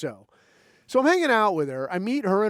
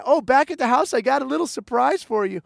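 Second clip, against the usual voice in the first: much higher, fast, bubbly mock girl voice; imitating her; 8 semitones higher, 5 dB louder than usual. The girl planning their night out, high mock chatter. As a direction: rattle it off high and eager.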